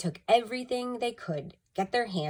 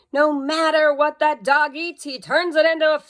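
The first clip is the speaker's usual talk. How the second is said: much higher, louder angry roar; roaring Father; 9 semitones higher, 8 dB louder than usual. A narrator's reading. Father fed up with the dog, roaring. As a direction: bellow in exasperation. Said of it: "roared Father".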